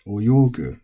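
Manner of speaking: oyogu with a clear drop after yo; accented. Shown as a demonstration. Teacher repeating the verb for learners, oyogu accented on yo. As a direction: hit the yo in oyogu, fall on gu. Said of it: "there's an accent there".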